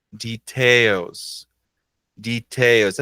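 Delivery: "Details" with a weighty second syllable; stress demonstration. Shown as a demonstration. Teacher asking learners to repeat, "Details" stressed on TAILS. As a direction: say "Details" as dee-TAILS, carefully. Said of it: "a sílaba mais forte".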